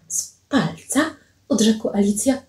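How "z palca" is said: high, soft, slow question; questioning echo. Acted. Alicja questions Paweł's accusation, high soft echo. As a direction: echo it softly, pitched well up.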